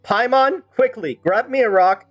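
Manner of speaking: usual speaking voice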